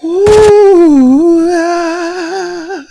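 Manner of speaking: loud, high wailing ooh; high shriek. Real, not acted. Reacting to the BMW drama with a high loud wail.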